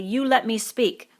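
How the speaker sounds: loud, slow, wounded demand; Piggy pleading to be heard; indignant. A narrator's reading. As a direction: insist on your turn, slowly. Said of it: "said Piggy indignantly".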